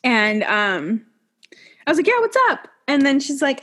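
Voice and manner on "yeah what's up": high eager reply. Retold or imitated. Her own eager reply, acted.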